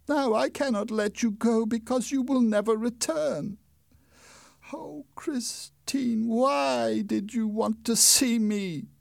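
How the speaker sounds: high, slow, tearful wail; crying. Acted. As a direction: weep the words out to her. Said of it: "Then Eric started to cry".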